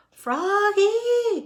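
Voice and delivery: very high, loud drawn-out call. A narrator's reading. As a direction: holler the name across the field. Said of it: "called the coach".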